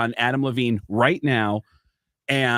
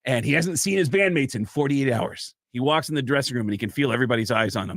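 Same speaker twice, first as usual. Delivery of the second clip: ordinary talk, nothing marked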